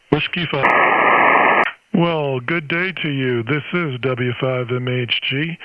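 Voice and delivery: ordinary talk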